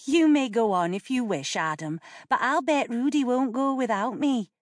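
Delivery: lightly voiced teasing young girl; a hint of a cheeky sister. A narrator's reading.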